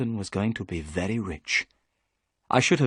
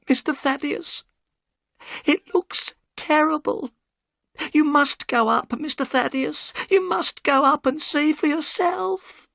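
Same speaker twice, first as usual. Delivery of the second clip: put-on much higher, old woman's fearful voice; trembling old housekeeper; frightened housekeeper; 3 dB louder than usual. A narrator's reading. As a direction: plead with relief and fear.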